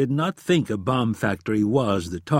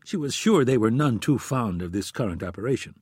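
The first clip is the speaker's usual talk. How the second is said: ordinary delivery, nothing marked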